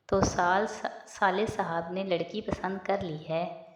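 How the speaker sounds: ordinary delivery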